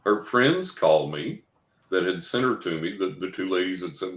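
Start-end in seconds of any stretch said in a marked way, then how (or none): none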